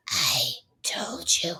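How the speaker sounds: low, slow, whispery menace building to the last word; hushed evil granny, each word spaced out. Retold or imitated. Granny correcting Nash after sunset, evil Granny voice. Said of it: "evil Granny at six thirty-five P M".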